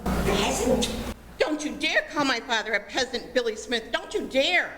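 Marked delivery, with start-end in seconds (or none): 1.4-4.7 quick, indignant squabbling; indignant child. Acted. Child defending father from Billy Smith, indignant. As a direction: rush to defend, rising on the last warning.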